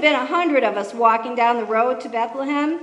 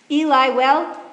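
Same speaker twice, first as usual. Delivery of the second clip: usual speaking voice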